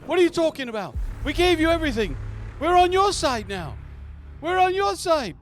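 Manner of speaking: a squeaky voiced crowd of scared men protesting; panicked men. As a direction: plead desperately as the cornered men. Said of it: "you can hear the men's like".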